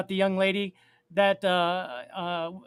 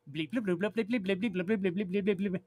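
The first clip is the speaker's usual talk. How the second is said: soft robotic bleeps and bloops; toy robot voice; 10 dB quieter than usual. Pretend. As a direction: beep quietly like a toy robot. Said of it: "my robot sounds".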